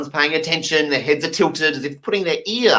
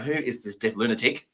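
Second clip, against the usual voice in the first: low soft wary mutter; muttered aside; 4 semitones lower, 9 dB quieter than usual. Retold or imitated. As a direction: grumble it under your breath. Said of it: "This poor woman's going".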